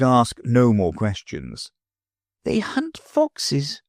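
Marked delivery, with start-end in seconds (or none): 2.4-3.8 much higher and very slow, musing; slow pondering child; thoughtfully. A narrator's reading. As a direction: turn the idea over slowly. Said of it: "Sylvie said thoughtfully".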